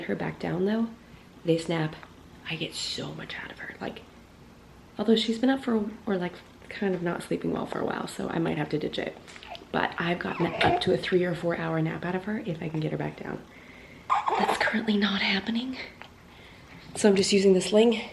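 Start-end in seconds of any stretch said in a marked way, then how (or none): 2.5-4.0 soft whisper. Real, not acted. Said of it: "when I don't have to whisper". 14.4-15.8 soft whisper. Real, not acted. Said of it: "when I don't have to whisper".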